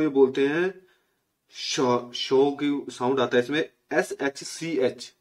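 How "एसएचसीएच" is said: एसएचसीएच spelled out slowly, each letter apart; teacher slowly sounding out एसएचसीएच; slow spelling demo. Shown as a demonstration. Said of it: "एसएचसीएच ऐसे पढ़ता है ये".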